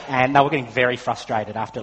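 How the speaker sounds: usual speaking voice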